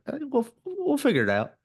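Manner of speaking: high-pitched happy-go-lucky self-quote; carefree reassurance. Retold or imitated. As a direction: toss it off like it's no big deal. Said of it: "And I was like".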